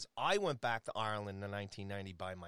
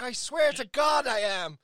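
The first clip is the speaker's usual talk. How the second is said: high, slow, loud Irish protest; Irish accent; 12 dB louder, slower than usual. Retold or imitated. Passenger swearing to doubting cab driver, shouted Irish brogue. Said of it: "I can put the Irish accent on".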